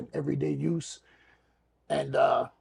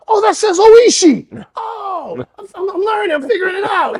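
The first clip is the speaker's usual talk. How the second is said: put-on high, loud childlike discovery; excited little kid; 15 dB louder than usual. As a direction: burst out like a kid who just learned to read. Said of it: "I was like a little kid".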